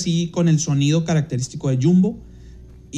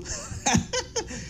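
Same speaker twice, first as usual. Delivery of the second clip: breathless laugh; 9 dB quieter than usual. Real, not acted. Laughing at own squeak.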